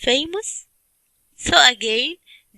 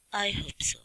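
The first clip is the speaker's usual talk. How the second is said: whispered aside; 8 dB quieter than usual. Real, not acted. Aside after question.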